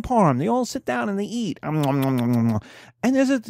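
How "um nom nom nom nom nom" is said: mock eating noises. Old people eating chicken parm.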